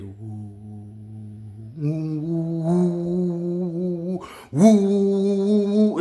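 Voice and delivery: swelling 'woo' like an oncoming train. Retold or imitated. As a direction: start moderate, rise to a loud howl. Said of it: "It'll get louder".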